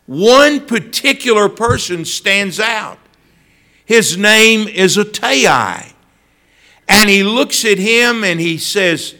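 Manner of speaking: ordinary talk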